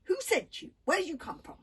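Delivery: loud, high fierce demand. A narrator's reading. Little girl confronting the captured boys, fierce and loud. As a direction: bark the questions at the intruders. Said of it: "she demanded fiercely".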